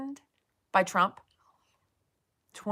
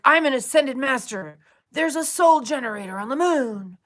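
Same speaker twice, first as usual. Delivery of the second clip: loud, higher mock guru proclamation; 5 semitones higher, 7 dB louder than usual. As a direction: ham up the self-proclaimed ascended master. Mocking a ranting streamer.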